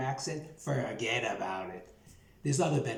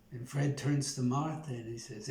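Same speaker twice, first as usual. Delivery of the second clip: ordinary talk, nothing marked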